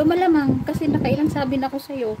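half-playful exasperated retort; mock exasperated scolding. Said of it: "ginaganyan ko siya".

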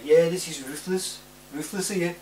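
high-pitched attempt at a friend's accent; mock accent, pitched well up; Ruthless. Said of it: "I can't do the accent".

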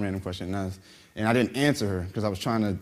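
normal talking voice

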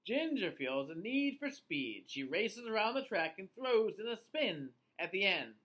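ordinary delivery

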